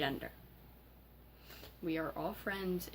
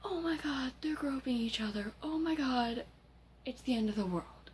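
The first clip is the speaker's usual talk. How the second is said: high-pitched hammed-up panic; imitated hysterical outcry, easing down at the end; mock screaming overreaction; 8 semitones higher, 3 dB louder than usual. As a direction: squeal it high, mocking their overreaction. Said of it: "started screaming and overreacting".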